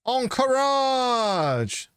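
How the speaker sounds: high, very slow spluttering yell; spray the word out in shock; spit take. Real, not acted. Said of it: "I just spat all over my microphone".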